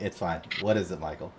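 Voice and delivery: low, soft tired reply; understated exhausted voice; weary mumble. Retold or imitated. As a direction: answer flatly from a hospital bed. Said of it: "Stanley's like".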